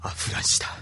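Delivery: hissed name under the breath; bitter whisper. Acted. Bitter naming of his rival. (憎しみを込めたささやき)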